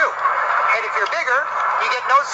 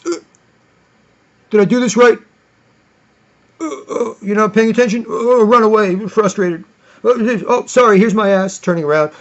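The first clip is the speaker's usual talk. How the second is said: louder, goofy put-on stranger voice; mock spazzing stranger; 5 dB louder than usual. Mocking strangers on his webcam, loud goofy voice. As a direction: ham up a flustered passerby.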